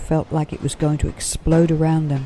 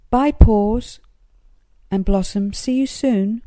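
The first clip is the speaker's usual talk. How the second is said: calling goodbye; 7 semitones higher, 4 dB louder than usual. Acted.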